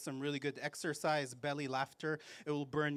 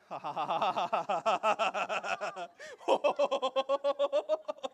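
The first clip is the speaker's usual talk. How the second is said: high, loud rolling laughter; face cream laughter; 7 semitones higher, 7 dB louder than usual. Shown as a demonstration. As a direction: laugh while rubbing the face. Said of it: "and start laughing".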